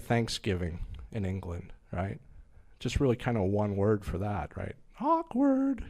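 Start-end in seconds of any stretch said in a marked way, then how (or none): 5.0-5.8 high sing-song, drawn out; sing-song awkward. Acted. Comedian summing up Thanksgiving in England, high sing-song. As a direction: pitch it way up like a teasing aside.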